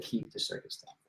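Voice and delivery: unvoiced, breathy whisper of the CEO's reply; whispered punchline. CEO telling the builder to keep it, whispered. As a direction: hush it like a secret.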